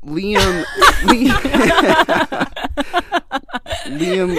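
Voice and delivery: high, helpless laughter through the name; laughing. Real, not acted. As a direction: crack up while saying the name. Cracking up over the accent attempt.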